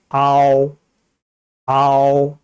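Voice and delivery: 아오 drawn out, ah gliding to o; diphthong demo. Shown as a demonstration. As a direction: finish 아오 on rounded lips without voicing a full 우. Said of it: "우는 소리 나지 않아요".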